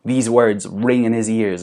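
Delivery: ordinary talk, nothing marked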